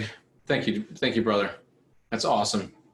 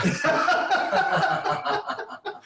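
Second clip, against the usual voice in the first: real laughter; 10 semitones higher than usual. Real, not acted. Host laughing at Craig's joke about his shop. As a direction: crack up at the joke. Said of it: "my wife's pet name for our shop".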